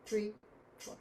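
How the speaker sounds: normal talking voice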